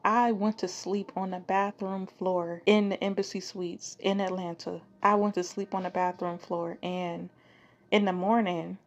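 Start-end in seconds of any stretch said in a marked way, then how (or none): none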